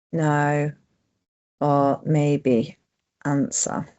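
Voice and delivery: very slow, word by word, long gaps between; slow dictation. Shown as a demonstration. Presenter dictating a survey change to the computer, slow and deliberate. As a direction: spell it out slowly so the dictation catches every word. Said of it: "So I'm going to just dictate".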